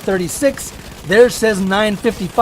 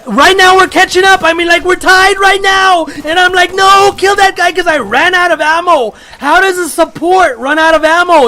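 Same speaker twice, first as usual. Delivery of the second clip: high, loud excited rant; worked up; 8 semitones higher, 5 dB louder than usual. Real, not acted. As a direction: shout it out in the heat of the match. Worked up over the close match.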